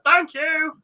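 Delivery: high, bright mock-child answer; eager child's reply. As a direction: chirp it like a grateful kid, amused. Cousin's eager answer in story.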